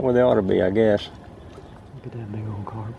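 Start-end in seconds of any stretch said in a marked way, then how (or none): 1.9-2.9 very soft whisper in awe; hushed whisper. Real, not acted. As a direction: whisper it, as if not to spook the fish. Hushed aside on spotting carp.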